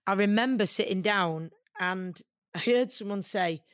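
normal talking voice

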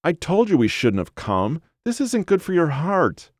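high-pitched whiny scolding; whiny wife. A narrator's reading. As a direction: nag your husband in a high whine. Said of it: "a whiny voice said from down the trail".

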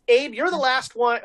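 ordinary talk

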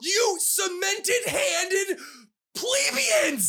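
very high, drawn-out insult shout; shrieked theatrical outrage; mock-angry rant. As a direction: hurl a grandiose insult in fake fury. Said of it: "I'm angry".

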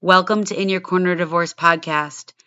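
punchy, low-pitched show opener; scripted podcast intro. Acted. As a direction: deliver a prepared opening monologue with firm, deliberate emphasis.

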